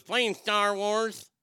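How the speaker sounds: slow, high, loud sing-song snitch; tattletale kid. Retold or imitated. Rat kid tells the teacher, slow high sing-song. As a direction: drag it out like a smug little snitch. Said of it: "some rat in the group goes".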